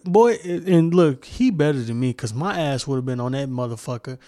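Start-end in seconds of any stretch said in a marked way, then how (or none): none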